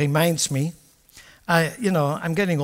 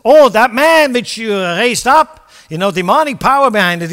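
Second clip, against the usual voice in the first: louder, higher, forceful exhortation; preaching at full force; 6 semitones higher, 12 dB louder than usual. Acted. Preacher urging the congregation to persevere, at full force. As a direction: drive it home like a fired-up preacher.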